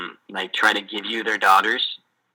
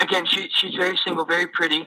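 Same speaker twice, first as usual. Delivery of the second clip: higher, selling his daughter like a product; salesman dad; 7 semitones higher than usual. Retold or imitated. Missionary voicing the dad selling his single daughter, higher. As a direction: praise her like a proud, pushy salesman.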